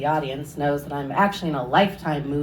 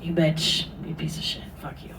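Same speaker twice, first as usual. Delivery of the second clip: muttered curses; 7 dB quieter than usual. Retold or imitated. Tired hitchhiker cursing the driver, a fading muttered whisper. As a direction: curse him under your breath. Cursing him under her breath.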